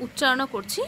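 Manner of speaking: ordinary delivery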